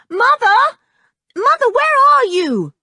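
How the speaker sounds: high, loud calling for mother; a girl bursting in, yelling for her mother; shouting girl. Acted. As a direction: shout it across the house. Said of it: "shouted George".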